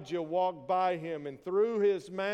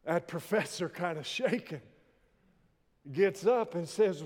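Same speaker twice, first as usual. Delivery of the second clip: normal talking voice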